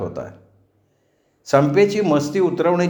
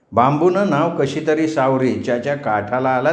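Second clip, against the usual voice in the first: usual speaking voice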